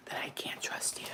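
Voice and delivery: hushed whisper under the breath. Real, not acted. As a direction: whisper it as a parting shot. Muttered parting shot to untrusted listeners.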